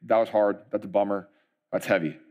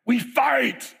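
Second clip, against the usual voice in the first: hyped rallying cry; 11 semitones higher than usual. Retold or imitated. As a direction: fire them up.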